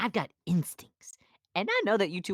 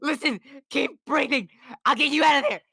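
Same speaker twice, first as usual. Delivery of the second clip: raised, urgent calling voice; 7 semitones higher than usual. Acted. Donkey calling to the princess he thinks was eaten. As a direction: shout into her belly.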